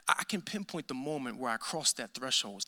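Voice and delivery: said low and soft, lingering on the moment; a low, intimate confession; hushed. Real, not acted. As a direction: lean in and hush the line. Repeats the line softly.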